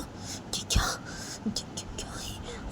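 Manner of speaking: unvoiced shocked stammer; stunned whisper. Boy whispering in shock after hearing everything. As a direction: barely breathe the repeated words. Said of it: "वो लड़का बोलता है".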